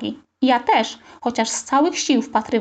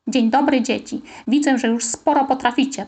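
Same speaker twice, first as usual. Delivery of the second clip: ordinary delivery, nothing marked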